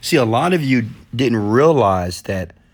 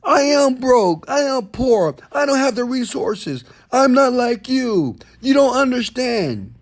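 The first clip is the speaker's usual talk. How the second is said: mock whiny excuse; 12 semitones higher than usual. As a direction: play the complainer defending his excuses. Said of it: "you validate it 'cause you say".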